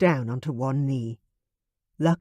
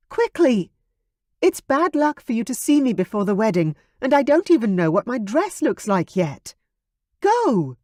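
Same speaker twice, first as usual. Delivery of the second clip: high, loud hurried urging; urgent; 8 semitones higher, 5 dB louder than usual. Acted. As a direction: hurry him out, bad luck. Veronica pushes him out.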